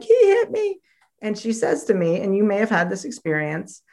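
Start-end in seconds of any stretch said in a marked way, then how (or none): 0.0-0.8 put-on high, louder wail of a hurt little girl; mock a tearful child tattling; crying child. Girl runs crying to neighbor mom: high mock wail. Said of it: "I'm crying".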